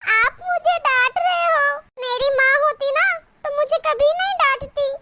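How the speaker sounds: hurt whining. Acted. Scolded daughter-in-law to mother-in-law, hammed-up whining. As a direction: wail like you have been wronged.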